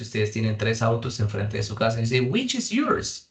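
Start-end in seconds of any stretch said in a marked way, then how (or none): 2.3-3.3 asking which car. Pretend. Acting out asking about cars.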